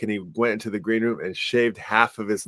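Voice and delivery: usual speaking voice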